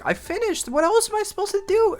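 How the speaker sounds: high mocking whine at the teacher; whiny kid protest. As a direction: squeak it out like a put-upon kid. Kid whining back at teacher.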